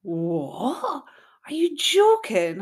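shocked disbelief. Retold or imitated. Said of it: "he was like".

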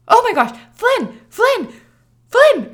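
high, loud, urgent calls to wake him; calling out urgently. Retold or imitated. Waking sleeping husband, water broke.

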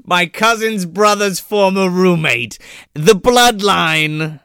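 slow, drawn-out villain pronouncement; booming echoing villain monologue. Retold or imitated. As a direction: boom it out like a storybook villain. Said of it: "evil monologue".

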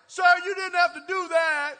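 high, loud stunned complaint; loud shocked protest. Retold or imitated. Barista soaked in coffee, loud and shocked. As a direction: cry out in disbelief.

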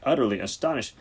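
plain reading voice

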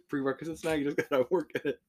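normal talking voice

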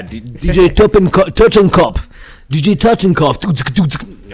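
loud, high, drawn-out mock German DJ name; German accent. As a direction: boom out the DJ name in German style. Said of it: "German accent".

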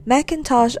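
ordinary delivery, nothing marked

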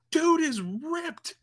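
very high, stretched-out words; awed gushing. Acted. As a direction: squeal it slowly in admiration. Gushing over actor's muscles.

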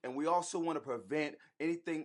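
quiet, earnest prayer voice; praying. Acted. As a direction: pray it softly, from the heart. Husband leads an opening prayer.

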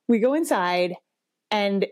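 ordinary talk

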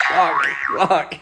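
loud exasperated cursing; frustrated swearing. Real, not acted. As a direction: blurt it out in frustration. Frustrated outburst after a quiz round.

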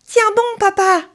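high, loud cry for his drowning father; desperate shout. Acted. Pinocchio urges his sinking father, loud cry. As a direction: shout to keep him afloat. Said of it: "crie-t-il".

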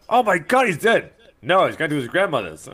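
very high, loud mock alarm; exaggerated shocked quote; hammed-up shock. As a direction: exclaim it high and loud like a cartoon panic. Acts out a show's dramatic exchange.

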